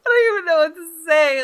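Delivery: giggling can't-believe-it reaction; laughing. Real, not acted. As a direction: laugh through the embarrassment. Laughing at the awkward ending.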